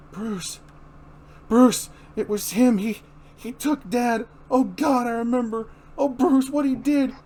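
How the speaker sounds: frantic remembering; panicked. Acted. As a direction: cry out in terror to a friend. Barbara panicking in hospital.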